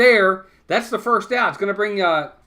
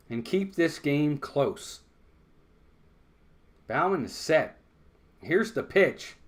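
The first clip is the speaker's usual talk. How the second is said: ordinary delivery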